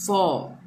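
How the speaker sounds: "for" ending on a long vowel, no r; British pronunciation. Shown as a demonstration. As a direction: drop the r in "for": "faw". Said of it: "अ से अ हो गया". ("for" को "फॉ" बोलें, r के बिना)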